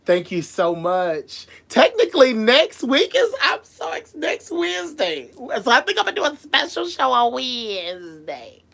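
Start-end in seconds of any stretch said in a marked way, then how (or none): 1.7-6.9 high, bubbling excitement; excited. Real, not acted. Host excitedly announcing her anniversary show. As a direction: gush about the anniversary.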